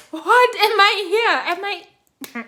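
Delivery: high, loud mock wail; crying. Woman breaking down in the locked room, acted crying. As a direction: re-enact a tearful, distraught outburst. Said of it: "I started crying".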